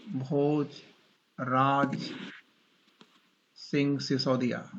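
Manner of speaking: very slow, word by word with long pauses; slow dictation. Real, not acted. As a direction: draw out Bhojraj, pause, then Singh Sisodia, for note-takers. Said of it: "मैं धीरे धीरे बोल रहा हूँ".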